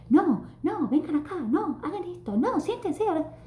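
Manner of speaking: high-pitched nagging classroom commands; shouting teacher. Acted. Teacher ordering her class about all the time, shouted directives. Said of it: "nos la pasamos gritando y dando directivas".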